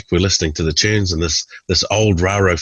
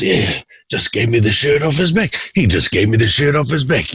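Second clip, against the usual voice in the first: higher, pleased repetition; lightly hammed-up Tim; Tim repeating; 6 semitones higher than usual. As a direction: say the phrase again with relish.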